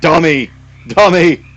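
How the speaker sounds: frog insult. Acted. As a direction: snap the insult at the dumb human. Frogs insult the player.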